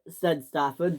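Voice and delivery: plain reading voice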